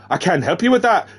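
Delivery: lilting faked Irish accent; wrong character's accent. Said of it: "Damn it, that was Sam".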